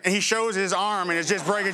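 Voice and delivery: ordinary talk, nothing marked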